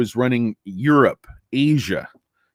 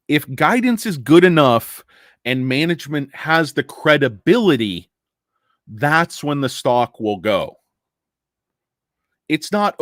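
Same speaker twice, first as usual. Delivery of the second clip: ordinary talk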